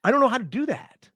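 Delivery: helpless mock whine. Repeats his confused line.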